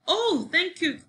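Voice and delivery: high-pitched grateful exclamation; high storybook cry of thanks. A narrator's reading. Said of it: "cried Sophie".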